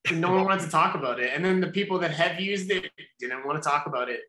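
ordinary delivery

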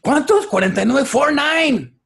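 high, loud astonished "¿Cuántos?"; mock loud exclaim. Imagined wife calls out the age, high and loud. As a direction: shout the age in playful disbelief. Imagined couple's exchange in Spanish.

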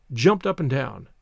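usual speaking voice

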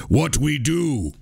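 slow simple caveman grunt. Shown as a demonstration. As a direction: talk like a dim caveman, slowly. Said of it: "you must use a caveman voice".

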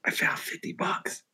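whispered, all nonchalant. Retold or imitated. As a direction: breathe it out secretly. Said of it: "whispered it and shit".

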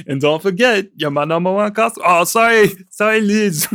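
flustered mock Austrian accent; Arnold impression. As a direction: break off the sales line to apologize, Austrian accent. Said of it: "And then he's just like".

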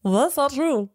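copied politician impression, a bit high; Obama. Shown as a demonstration. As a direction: try a president's denial. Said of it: "How about Obama?".

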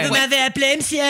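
playful sultry secretary; flirty secretary. Acted. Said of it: "la secrétaire".

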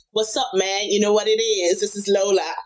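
Irish speaker's best attempt at an American accent. Irish guest auditions her best American accent for the hosts, American accent. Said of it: "giving us your best American accent".

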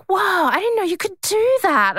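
high-pitched mock astonishment; impressed quote in a high put-on voice; amazed young employee. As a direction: imitate a youngster's wide-eyed amazement, very high. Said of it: "And he was like".

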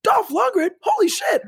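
very high-pitched starstruck exclamation; thrilled disbelief. Retold or imitated. Retelling reaction to the cast popping up, thrilled disbelief. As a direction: replay your amazed fan reaction, pitch shooting up.

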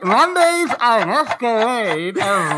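laughing, slow and garbled through a mouthpiece; mouth full. Real, not acted. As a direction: laugh while talking around a mouth guard. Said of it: "what I'm saying with my mouth full".

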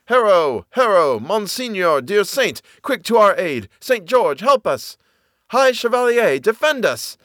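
high, ringing battle cries; crying out. A narrator's reading. Phantom army heard by the soldier, crying out for aid. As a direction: call on the saint for help. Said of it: "the Latin-bred soldier heard the cry".

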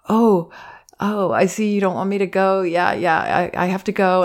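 imitating a hesitant parent leaving a crying toddler; insecure parent. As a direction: waver as if unsure about leaving. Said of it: "the parent's insecure about it".

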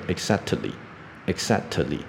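"exactly" with an extra vowel after the t, exact-uh-ly; student mispronunciation. Retold or imitated. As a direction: imitate learners adding "tuh" inside "exactly". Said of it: "或者是学生会念成". (模仿学生念"exactly"，t后面多加一个母音)

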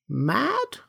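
put-on high, soft, childlike query; cheerful question. A narrator's reading. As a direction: ask it brightly and innocently, lifting the pitch. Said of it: "asked Lionel cheerfully".

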